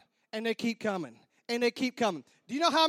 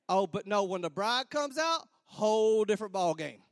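ordinary talk, nothing marked

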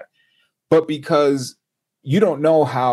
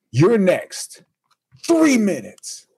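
clipped order, pitched higher; mock director barking the time limit; curt director; 8 semitones higher, slower than usual.

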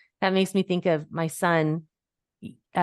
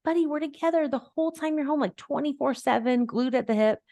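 tender mom voice; 8 semitones higher than usual. Retold or imitated. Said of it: "I'm like".

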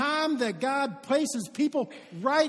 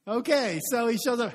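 ordinary talk, nothing marked

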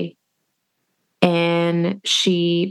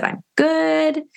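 grandma's reply; 9 semitones higher than usual. Retold or imitated. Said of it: "she said".

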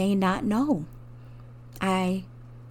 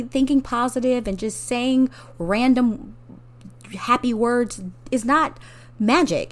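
impassioned outburst; 5 semitones higher, 5 dB louder than usual. Real, not acted. Impassioned rebuttal of positivity.

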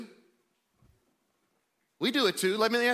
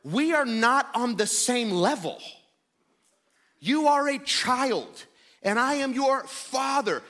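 raised, emphatic parental scolding; deliberate, higher-pitched stern dad; mock-loving reminder; 5 semitones higher, slower than usual. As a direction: lay down the law as a father, hammed up. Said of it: "I will very lovingly remind her".

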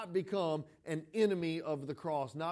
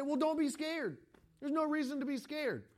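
higher, sing-song brush-off; mock breezy reassurance; 8 semitones higher than usual. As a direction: play the clueless helper who waves fear away. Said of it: "the worst thing you can do to someone who's scared is say".

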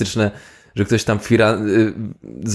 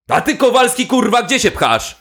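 yelling officer; 9 semitones higher than usual. Acted. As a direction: bawl out the recruit. Officer yells at recruit.

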